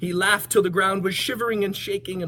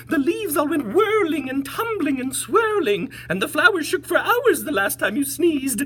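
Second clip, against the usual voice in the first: very high, louder chattering plea; high monkey voices; 4 dB louder than usual. Acted. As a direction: chatter as anxious little monkeys. Monkeys speaking.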